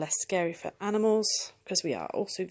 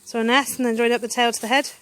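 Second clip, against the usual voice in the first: louder encouraging call across the garden; calling out outdoors; 5 semitones higher, 14 dB louder than usual. Real, not acted. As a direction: call out over the fireworks noise. Calling instructions to child outdoors.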